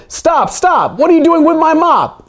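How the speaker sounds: high, loud mock yell from the janitor; hammed-up high-pitched janitor shout. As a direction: squeal after the thief with the mop. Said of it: "he heard someone yell".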